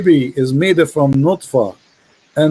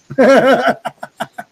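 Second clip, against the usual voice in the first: higher-pitched burst of laughter; real laugh; 6 semitones higher than usual. Real, not acted. Laughs after challenging the caller.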